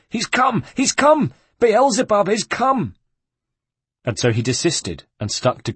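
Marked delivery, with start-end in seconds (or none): 0.1-3.0 high, loud shrieks of alarm; panicked screaming. A narrator's reading. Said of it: "they started to scream".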